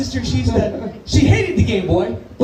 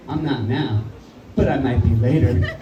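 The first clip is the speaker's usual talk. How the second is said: low, slow payback line; menacing deadpan; 10 semitones lower than usual. Retold or imitated. Sister gets revenge on brother, low cold deadpan. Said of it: "she looks me right in the eyes".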